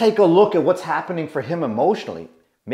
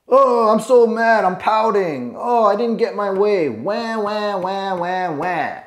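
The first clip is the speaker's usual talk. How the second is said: higher, whiny sulking with fake crying wahs; mock pouting tantrum; 5 semitones higher than usual. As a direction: ham up a sulking child. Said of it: "withdraw my energy like a little girl".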